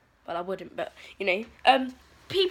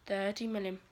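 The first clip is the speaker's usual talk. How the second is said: softer, lower thirty million; muttered repeat; 4 semitones lower, 10 dB quieter than usual. Real, not acted. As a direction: drop it to a disbelieving mutter.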